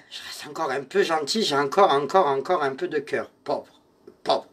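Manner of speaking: plus grave et plus doux, presque murmuré; voix douce de médium. Acted. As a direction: apaiser Pierrot comme en transe. Voix douce parlant à Pierrot. (elle rassure Pierrot à voix basse et grave)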